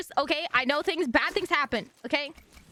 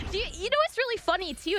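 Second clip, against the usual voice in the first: ordinary delivery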